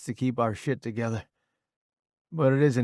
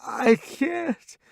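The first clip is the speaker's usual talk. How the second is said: choked; 3 dB louder than usual. A narrator's reading. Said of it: "I choke".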